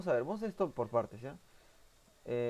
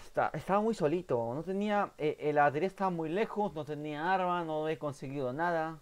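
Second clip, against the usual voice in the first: worked-up complaining; 5 semitones higher, 8 dB louder than usual. Real, not acted. Se queja tras morir en partida.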